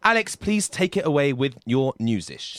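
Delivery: radio host introducing a segment; show announcer. Acted.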